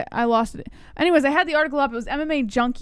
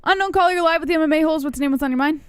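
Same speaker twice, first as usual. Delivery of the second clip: radio announcer; 5 semitones higher, 4 dB louder, faster than usual. Acted. Radio host announcing caller.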